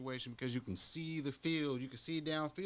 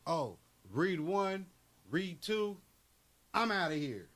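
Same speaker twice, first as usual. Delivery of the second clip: mock quarterback bailing; run-first quarterback; 4 semitones higher, slower than usual. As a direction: act out a scrambler bailing early. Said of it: "running quarterbacks that can go".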